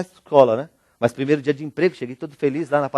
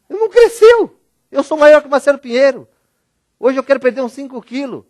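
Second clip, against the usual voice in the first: high, loud, amazed outburst; high astonished shout; excited exclaim; 9 semitones higher, 5 dB louder than usual. Retold or imitated. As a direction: announce it like big news. Exclaiming friend stayed short.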